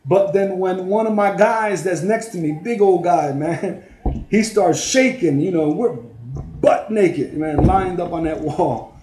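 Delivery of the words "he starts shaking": ordinary talk, nothing marked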